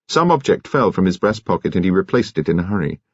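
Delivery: ordinary delivery, nothing marked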